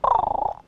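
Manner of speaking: one long, loud, rough caw; crow's last caw. Retold or imitated. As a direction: let out a single drawn-out crow croak. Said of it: "he said".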